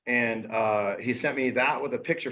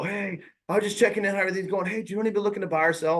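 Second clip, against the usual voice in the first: higher-pitched imitation of a sales caller; cold-call salesperson; 6 semitones higher, faster than usual. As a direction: act out a routine prospecting call, pitched up. Mimicking a routine sales check-in call.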